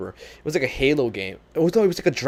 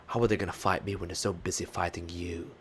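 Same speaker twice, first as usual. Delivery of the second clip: low, soft character quote; Lucifer; 5 semitones lower, 9 dB quieter than usual. Acted. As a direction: drop it down low and quiet. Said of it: "supposed to say some Lucifer lines".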